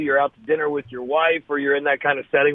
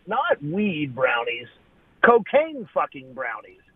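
slow, stress on cocaine; slow emphatic correction; slower than usual. Real, not acted. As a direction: lean hard into cocaine fucking brownies.

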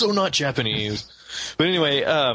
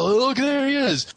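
mock discovery; 10 semitones higher than usual. Acting player reaching dungeon's end.

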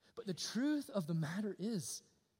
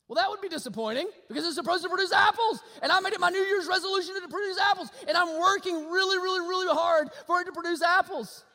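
louder, higher pulpit build; full-force preaching; 10 semitones higher, 8 dB louder than usual. Acted. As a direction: drive it home to the congregation.

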